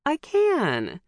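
high, pouty insistence; insistent. Acted. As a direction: insist like a sulky kid. Insisting after being teased.

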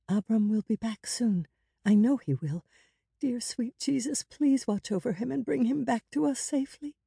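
high-pitched, hopeful plea; anxious woman praying. A narrator's reading. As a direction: think aloud, rising into earnest prayer. Kara's thoughts turn to prayer.

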